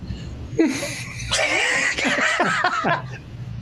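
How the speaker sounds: high silent wheeze of a laugh; wheezing laugh. Real, not acted. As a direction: laugh breathlessly. Laughing at Norm joke.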